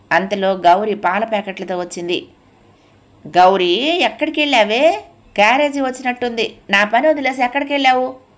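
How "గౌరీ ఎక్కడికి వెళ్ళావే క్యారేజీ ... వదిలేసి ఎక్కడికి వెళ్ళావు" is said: high-pitched, mildly raised scolding; loud scolding shout. A narrator's reading. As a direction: call the maid to account. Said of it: "గట్టిగా అరిచింది కామేశ్వరమ్మ".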